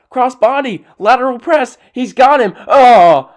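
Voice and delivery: high, loud hammed-up play-by-play; mock commentator call. Vlogger mocking the commentator's pet move call, mock play-by-play. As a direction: mimic an excited announcer calling a pinfall. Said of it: "He'll say that every time".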